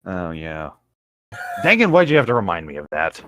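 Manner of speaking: ordinary talk, nothing marked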